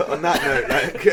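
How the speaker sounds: loud, high, through laughter; real laughter breaking up the words; laughing. Real, not acted. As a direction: try to sign off while cracking up. Laughing at wrap-up.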